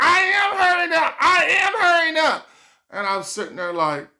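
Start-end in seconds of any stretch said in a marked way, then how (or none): 0.0-2.5 much higher, louder snapping shout; irritated shout. Retold or imitated. A girlfriend snapping at her boyfriend, loud mock shout. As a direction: yell back like a girlfriend fed up.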